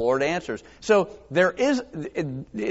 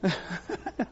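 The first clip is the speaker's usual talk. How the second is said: short laugh; 3 semitones higher, 10 dB quieter than usual. Real, not acted. Laughs at own impression.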